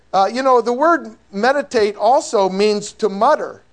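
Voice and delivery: ordinary talk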